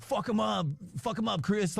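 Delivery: high, far-off cheering voice of a rowdy extra; rowdy crowd cheer. Retold or imitated. Extras at the gambling rig cheering the fight, high rowdy yell.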